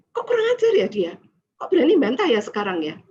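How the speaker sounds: high, loud, fast indignant thinking; a mother fuming at her child's backtalk, fast and loud; outraged mom, high-pitched and rushed; angry mother. Retold or imitated. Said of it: "Saya tambah marah".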